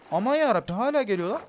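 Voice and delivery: high, loud frightened yell; panicked shout. Real, not acted. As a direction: shout in panic as it keeps coming. Monster still chasing him.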